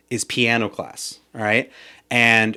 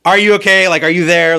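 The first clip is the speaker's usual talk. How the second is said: loud, high check on someone not responding; acted-out alarmed shout, pitched well up; urgent calling out; 7 semitones higher, 8 dB louder than usual. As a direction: call out to rouse someone who is passing out. Retold calling to unresponsive, overdosing girl.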